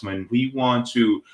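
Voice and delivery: ordinary talk, nothing marked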